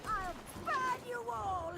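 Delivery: slow, loud, menacing threat; furious threat. Acted. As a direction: spit the threat out slowly. Combat threat with fire.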